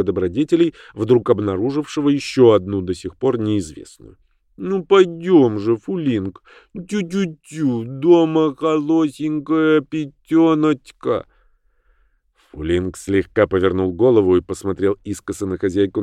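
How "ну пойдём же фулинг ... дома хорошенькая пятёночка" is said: put-on higher, slow cooing baby talk; kindergarten teacher voice. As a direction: lisp and coo at the lapdog. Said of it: "засюсюкала".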